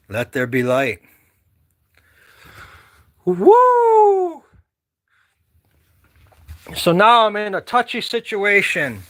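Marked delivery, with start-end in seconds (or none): 3.2-4.4 high, loud whoops of joy; whooping. Real, not acted. Vlogger whooping at the snowy yard, high and loud. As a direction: let out excited hoots. Hooting at the snow.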